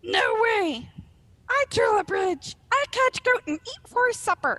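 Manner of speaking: high, loud, squeaky troll protest; shrieking small troll. Acted. Small troll claims the bridge and goat, high shriek. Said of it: "shrieked Tharg".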